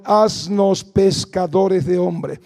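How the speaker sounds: slow, drawn-out prayer line; modeled prayer. Shown as a demonstration. Preacher leading the congregation in prayer, slow. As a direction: pray it slowly for them to repeat. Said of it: "vamos a decirle".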